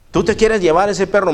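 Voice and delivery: a hint of a skeptical clerk.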